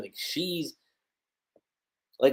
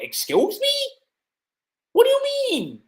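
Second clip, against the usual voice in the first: much higher, shocked "what do you mean"; stunned, voice jumping way up; shocked disbelief; 4 dB louder than usual. Retold or imitated. Yoko reacting to Lucy's words with high shocked disbelief.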